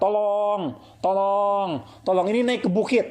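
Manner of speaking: high, mock cry for help; a mother calling out for help while climbing. Dramatized plea, high and pleading. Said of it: "meronta, menangis, teriak-teriak".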